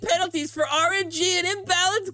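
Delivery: high whiny voice with a mock sob; Scott voice. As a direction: whimper the complaint like a sore loser. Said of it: "Should I do the Scott voice?".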